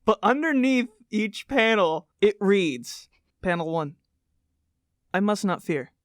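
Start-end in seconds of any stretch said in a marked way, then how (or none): 3.4-5.9 dramatic poster recitation. Acted. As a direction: intone each phrase like a cult creed. Reads poster litany aloud.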